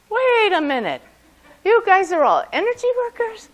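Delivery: retold astonishment, drawn up high and loud; incredulous realization. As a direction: stop everything, high-pitched and disbelieving. Retold moment of dawning disbelief.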